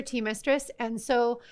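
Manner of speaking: ordinary delivery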